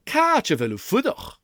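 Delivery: lively Gaelic "Catch a veil u fudach". Shown as a demonstration. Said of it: "Listen again".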